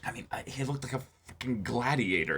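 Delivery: hushed, breathy aside; whisper. Real, not acted. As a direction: whisper it like a secret. Awed aside about the gladiator.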